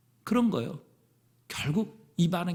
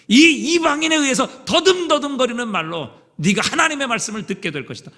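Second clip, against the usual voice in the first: rebuking captors; 7 semitones higher, 9 dB louder than usual. Acted. Foreign captors rebuking captive Israel.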